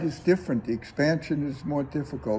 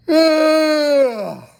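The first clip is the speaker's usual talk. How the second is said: big open-mouthed yawn, much higher and louder; loud demo yawn; 12 semitones higher, 8 dB louder than usual. Shown as a demonstration. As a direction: let a full noisy yawn out for the class. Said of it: "And you can make noise".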